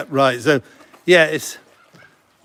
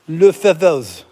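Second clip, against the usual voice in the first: mock French; 4 dB louder, slower than usual. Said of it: "how do you say feathers in French?".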